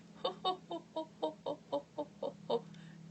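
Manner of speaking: high, strained mock laugh held long; imitating a pained laugh. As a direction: copy his hurting, held-in laugh. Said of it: "so he was laughing like".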